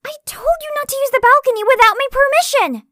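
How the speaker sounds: a very high, very loud scolding yell; furious shout. Acted. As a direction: yell at him for breaking the rule. Scolding him for smoking on balcony.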